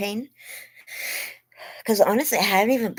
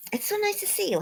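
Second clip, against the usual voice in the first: much higher, softer gushing welcome; sweet greeting; 4 dB quieter, faster than usual. Real, not acted. Streamer warmly welcoming a viewer to the live.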